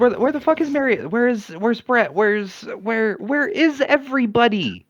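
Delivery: high, bewildered demand; frantic searching. Acted. Player asking game master where everyone went, frantic. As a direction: fire off frantic questions at a high pitch.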